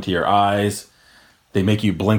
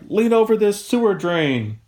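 much higher, sing-song put-on order; mock instructor; 12 semitones higher than usual. Mocking the academy instructor giving orders, high put-on voice. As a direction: parody the instructor's cheerful command.

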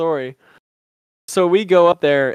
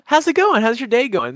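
much higher and louder customer-service voice; fake cheerful; 7 semitones higher, 3 dB louder than usual. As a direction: chirp it like a barista. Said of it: "imitating server cheer".

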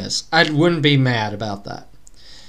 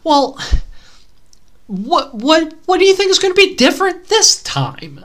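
exasperated disbelief; 11 semitones higher than usual. Retold or imitated. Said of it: "And I'm just like".

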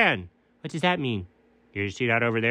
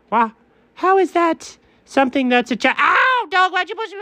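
shouting; 10 semitones higher than usual. Acted. Pushed down the hill.

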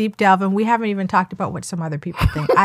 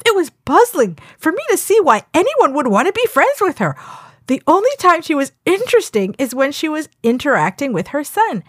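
raised, animated reading with high jumps; sassy comment reading; 6 semitones higher than usual. Acted. Co-host acting out a viewer's harsh review, sassy. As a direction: perform the review with sass. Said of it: "Then it was just like".

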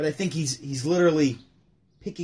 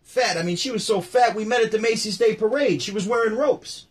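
high, loud stand-up punchlines; Rodney Dangerfield bit; 7 semitones higher, 4 dB louder than usual. Retold or imitated. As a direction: belt it out like a Vegas comic. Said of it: "so he goes".

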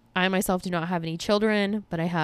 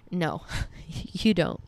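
laughing; slower than usual. Real, not acted. Self-mocking laugh.

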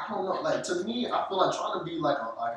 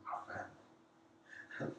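hushed dread; 19 dB quieter, much slower than usual. Real, not acted. Asked to imitate her family.

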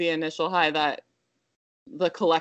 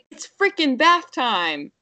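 higher, slow mock toddler announcement; sassy toddler; 7 semitones higher, 4 dB louder, slower than usual. Mom imitates her sassy toddler upstairs, high and drawn out. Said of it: "she's upstairs and she's like".

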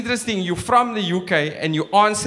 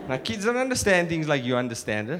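normal talking voice

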